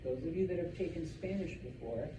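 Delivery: usual speaking voice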